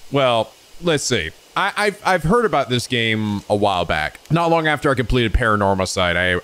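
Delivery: normal talking voice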